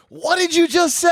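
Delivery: high, loud mock disbelief; incredulous shout. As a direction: shriek it slowly as if floored by the news. Reacting to hearing very cheap rent.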